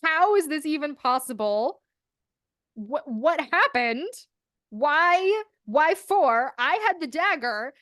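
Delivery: stunned and bewildered, half-mocking; confused disbelief. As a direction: Emma baffled that her powers went elsewhere. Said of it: "And she's like".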